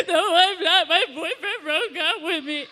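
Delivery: hammed-up tearful wail, half laughing; a sobbing friend played for laughs, half laughing; mock crying. Imitating a friend crying over a breakup, mock sobbing. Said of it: "they're just like".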